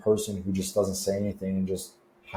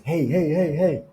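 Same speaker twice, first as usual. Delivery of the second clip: calling out for attention; 8 semitones higher than usual. Retold or imitated. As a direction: call out to get a stranger's attention. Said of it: "get their attention".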